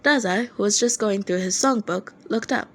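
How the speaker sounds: plain reading voice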